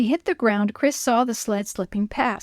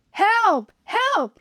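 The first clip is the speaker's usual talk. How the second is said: high desperate shouts; shouting for help; 11 semitones higher, 3 dB louder than usual. A narrator's reading. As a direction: yell for help at full voice. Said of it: "he shouted".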